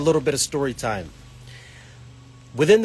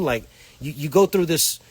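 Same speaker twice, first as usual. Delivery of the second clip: ordinary talk, nothing marked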